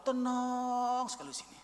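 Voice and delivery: soft, long-drawn "tenang"; heartfelt relief. Retold or imitated. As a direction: stretch "tenang" out softly in relief. Retold testimony of deep peace. (lega dan damai, "tenang" dipanjangkan lembut)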